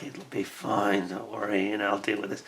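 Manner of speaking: quietly soothing, slowed right down; reassuring. Retold or imitated. Calming worried colleague in meeting.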